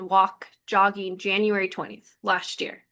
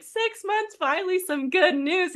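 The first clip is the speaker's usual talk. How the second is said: much higher, relieved exclamation; relieved joy; 8 semitones higher than usual. Retold or imitated. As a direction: breathe out the relief happily. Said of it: "I was like".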